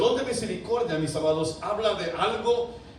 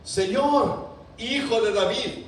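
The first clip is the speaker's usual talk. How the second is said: high, loud plea of the blind men; crying out; 6 semitones higher, 4 dB louder, slower than usual. Acted. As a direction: call out to Jesus across the crowd.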